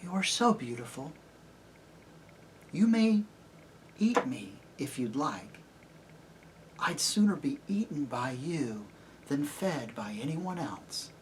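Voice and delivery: soft, hesitant surrender with long pauses; timid, awed horse. A narrator's reading. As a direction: offer yourself in hushed wonder. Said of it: "shaking all over".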